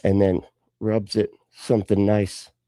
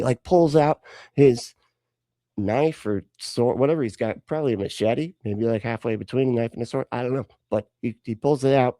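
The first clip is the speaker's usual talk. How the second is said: ordinary talk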